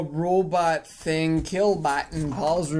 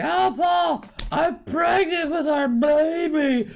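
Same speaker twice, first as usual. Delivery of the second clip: high-pitched mock girlfriend voice; hammed-up, high and gushing news; 12 semitones higher than usual. Girlfriend announces pregnancy.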